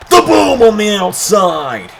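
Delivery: high, slow loud hype; shouted call. Real, not acted. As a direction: stretch out the big call. Big spot called live.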